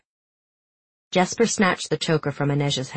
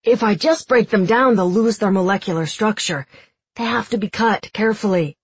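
Jesper voice; 4 semitones higher, 3 dB louder than usual. Acted. Jesper explaining while working.

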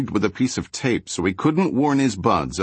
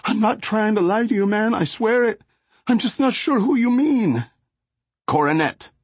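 high, nervous street-thug voice; Flacco gangbanger voice; 14 semitones higher than usual. Acted. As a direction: play the jittery gangbanger.

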